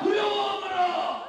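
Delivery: slow shouted slogan; roaring rallying speech. Real, not acted. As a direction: chant it for the crowd to repeat. Leader chants slogan for crowd.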